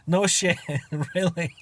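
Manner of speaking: high, loud and laughing; a laughing, sarcastic really; mock surprise. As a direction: fake astonishment through laughter. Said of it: "I was like".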